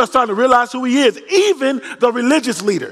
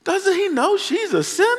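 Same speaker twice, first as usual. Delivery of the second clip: scandalized Pharisee; 5 semitones lower than usual. Retold or imitated. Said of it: "the Pharisee is like".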